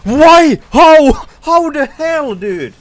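high, loud raging yell; furious shout. Real, not acted. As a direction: scream your frustration at the game. Rage after dying.

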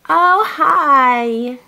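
high, loud, drawn-out greeting. Real, not acted. Mother greeting her baby in the morning, delighted baby talk.

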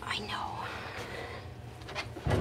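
soft comforting whisper. Real, not acted. Groomer comforting the wet dog being toweled, whisper. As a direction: reassure him in a breath.

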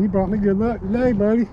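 ordinary delivery

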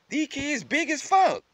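high, drawn-out mock astonishment; exaggerated disbelief; acted amazement. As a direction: marvel in a slow, high voice. Acts out amazement at kids' size.

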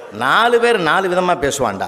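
ordinary talk, nothing marked

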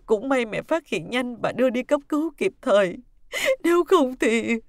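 loud tearful account breaking into sobs; choked crying. Acted. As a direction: recount it weeping, until words fail. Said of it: "Bà Liễu khóc nghẹn không nói thêm được".

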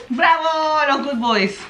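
loud, high cheer for the dog; excited praise. Real, not acted. (¡Bravo! fuerte y alegre)

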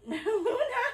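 high laughing call. Real, not acted. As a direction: laugh while calling her name.

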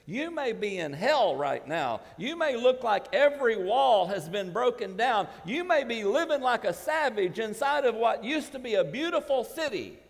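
rising, loud preacher's build to a climax; full-force pulpit preaching. Acted.

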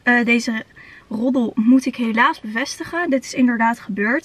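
normal talking voice

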